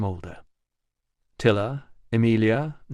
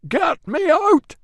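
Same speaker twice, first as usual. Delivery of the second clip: very high, loud, slow panicked shout; desperate plea; 6 dB louder, much slower than usual. A narrator's reading. Trapped man pleading to be freed. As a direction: beg to be let out. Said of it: "cried the voice".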